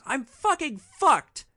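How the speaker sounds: high, loud, drawn-out scream of despair; imitated scream. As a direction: scream it as the desperate passenger. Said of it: "then she just screams".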